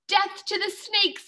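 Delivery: high, slow pirate battle cry; a howl as he leaps at the snakes; drawn-out fierce howl. Acted. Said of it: "he howled".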